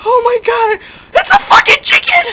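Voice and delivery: very high, loud squealing shriek; shocked shriek. Pretend. Player discovering a chicken, high shocked shriek. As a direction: shriek it at the top of your range.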